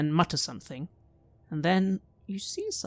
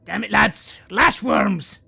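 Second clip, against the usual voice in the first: loud, high startled soldier's shout; Kurt shouting; 5 semitones higher, 8 dB louder, slower than usual. Acted. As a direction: bark a warning to the lads. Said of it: "You hear Kurt say".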